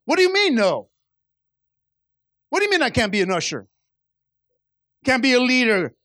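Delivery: high, loud mock outrage at being told no; indignant protest, hammed up. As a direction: play the offended churchgoer. Churchgoer mad at God's no.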